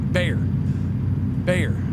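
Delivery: bear, bear, quick, one breath; bear said fast. Shown as a demonstration. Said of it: "if you go slow".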